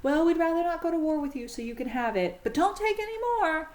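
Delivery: the allies giving in but begging for limits; nervous mock plea. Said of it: "And they say".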